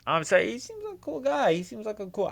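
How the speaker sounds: sarcastic squeaky sorry; mock polite.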